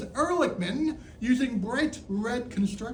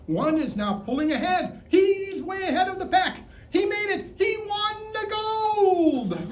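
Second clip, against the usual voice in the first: excited race commentary; 10 semitones higher, 4 dB louder than usual. Pretend. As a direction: call the winning finish like a sportscaster. Mock race commentary reaches its climax.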